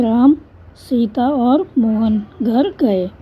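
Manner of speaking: slow, halting after Ram, then word by word; comma pause after Ram. Shown as a demonstration. Teacher demonstrates the comma to pupils, pausing slowly after Ram. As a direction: stop briefly on Ram and take the list slowly. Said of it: "हमें रुकना है ज़रा सा".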